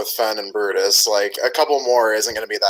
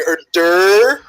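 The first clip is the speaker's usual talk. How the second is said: high, louder dopey drone; mock dim-witted noise; 8 semitones higher, 6 dB louder than usual. Player mocking a clueless trainer, dopey put-on voice. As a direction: play the clueless oaf, stretched out. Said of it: "Or is he just sitting here going".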